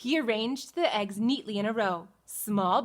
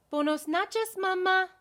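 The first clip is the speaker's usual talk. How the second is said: put-on baby chick voice; 9 semitones higher than usual. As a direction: greet mama like a fresh chick. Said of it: "said the baby chick".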